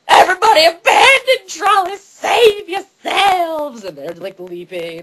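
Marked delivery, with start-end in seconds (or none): 0.1-3.8 high, loud mock-panicked yell; over-the-top trolley driver. Trolley driver warning passengers before the crash, hammed-up panicked yell. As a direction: bellow a hammed-up evacuation cry, stretching the end.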